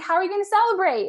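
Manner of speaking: high, loud, fast and eager; eager question. Retold or imitated. Coach eagerly asking her client about celebrating, excited. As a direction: bounce the question out.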